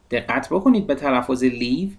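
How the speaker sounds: ordinary talk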